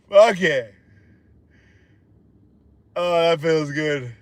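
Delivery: ordinary talk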